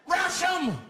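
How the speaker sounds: high, loud charge order; acted frenzied war cry; screamed rallying cry. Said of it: "He screamed out".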